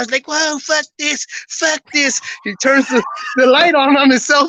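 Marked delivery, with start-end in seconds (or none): 0.3-2.2 mock panic, high and drawn out; freaking-out friend. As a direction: imitate a friend freaking out, pitched up without yelling. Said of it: "One of my friends is freaking out".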